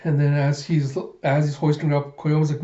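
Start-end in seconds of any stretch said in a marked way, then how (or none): none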